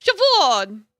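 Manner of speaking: Chavorn shouted loud in triumph; triumphant exclamation. Shown as a demonstration. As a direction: Chavorn called out proudly. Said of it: "The B H is pronounced like a V".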